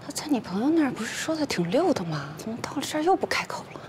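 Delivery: high, agitated questioning; anxious exasperation. Acted. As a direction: fret as your child freezes at the interview. Worried parent as child stays silent.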